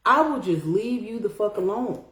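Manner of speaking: normal talking voice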